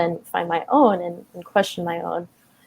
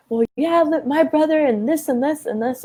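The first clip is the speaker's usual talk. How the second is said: put-on a seven-year-old sassing her dad out of spite; spiteful kid talking back; 8 semitones higher than usual. To her dad at dinner, a spiteful seven-year-old, high and defiant.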